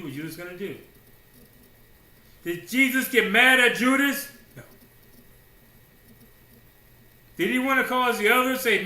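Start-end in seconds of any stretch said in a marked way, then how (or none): 2.4-8.8 loud, high, ringing pulpit declaration; preaching at full force. Real, not acted. Preacher hammering the point to the congregation, full voice. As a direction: drive it home to the congregation.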